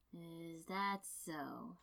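very slow, soft and uninterested; inflectionless. A narrator's reading. Kakashi brushes off his student without looking up, soft and uninterested. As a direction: let it drop out flat and lazy, barely caring. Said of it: "voice inflectionless".

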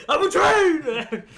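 loud, high train-whistle howl; imitated train horn. Joking about mom's train scare, high train shout. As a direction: bellow it high and drawn out like a train. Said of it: "my mom would scare the shit out of me".

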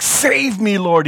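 high-pitched cry for help. Acted. Peter sinking in the waves, crying out. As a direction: cry out as you start to sink. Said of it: "he cried".

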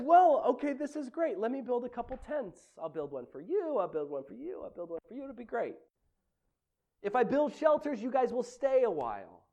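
a lightly voiced, excited Peter rambling; eager Peter. Peter blurting to Jesus on the mountain, lightly eager. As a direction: play Peter too eager to please. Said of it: "He says".

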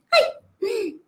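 small high squeak of a voice; a tiny ink blot crying out; little voice crying ay. Acted. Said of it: "escuchó una vocecita que decía".

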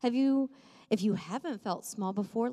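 usual speaking voice

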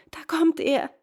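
high, loud cry of alarm; woman's startled high shout. A narrator's reading. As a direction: cry out a sudden warning. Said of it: "schrie die Frau".

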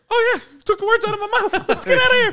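very high, loud squeaky falsetto; comic high-pitched scaredy-cat voice; panicked squeal. Retold or imitated. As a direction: squeal it in a rush, half laughing. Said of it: "Your voice".

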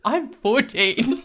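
slow, loud, laughing disbelief. Real, not acted. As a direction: stretch it out while laughing. Mocking the hiring comment.

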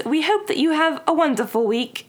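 high-pitched mock British sign-off; hammed-up British accent; put-on British accent. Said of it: "I'm so bad at this".

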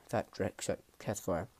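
usual speaking voice

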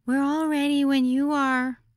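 put-on high, polite girlish voice; polite young girl. A narrator's reading. Hostess telling the guest they're ready, high and polite. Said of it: "Grace Ann said politely".

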